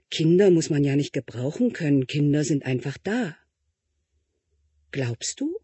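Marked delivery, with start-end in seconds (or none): 4.9-5.6 soft, doubtful little question; hushed doubting child; small quiet voice. Acted. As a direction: ask it barely above a breath.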